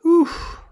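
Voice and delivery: loud, high puff of breath; high exhale of recovery, retold; relieved oof. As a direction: blow out the last of it. Acts regaining control after crying.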